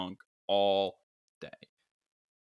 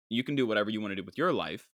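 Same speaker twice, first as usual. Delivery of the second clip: ordinary talk, nothing marked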